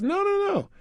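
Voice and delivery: mock protest jumping way up high; squeaky denial. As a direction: imitate John's shrill panicked no, no, no. John denies having a virus.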